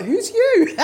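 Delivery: high, loud laughing question. Real, not acted. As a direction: squeal it out through helpless laughter. Laughing over misheard nickname.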